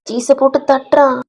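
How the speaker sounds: usual speaking voice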